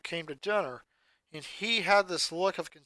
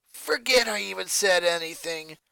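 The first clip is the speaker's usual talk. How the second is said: annoyed; 4 dB louder than usual. A narrator's reading. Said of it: "she stated".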